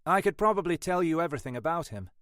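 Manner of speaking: lightly voiced young tech expert, self-assured; brief the inspector as a confident young expert; Sean's character voice.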